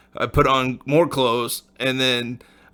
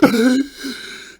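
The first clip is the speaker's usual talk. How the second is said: startled shriek; 13 semitones higher than usual. Retold or imitated. Jolted awake by cold water.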